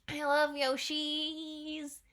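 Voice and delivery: slow, stretched I love Yoshis; fond drawn-out. Real, not acted. Fan coos over the Yoshis, stretched and slow. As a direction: linger on love, adoring.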